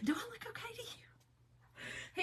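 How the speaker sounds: slow, whispered plea; mock whisper. To the neighbor, a slow, pitiful mock whisper. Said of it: "And I'm like".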